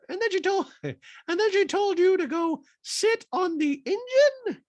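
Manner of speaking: high, slow falsetto; mock-appalled housewife question; Dorit impression voice. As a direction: draw it out high and loud.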